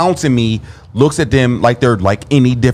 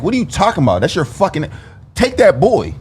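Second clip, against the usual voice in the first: retold angry outburst; 8 semitones higher than usual. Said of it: "And I was pissed".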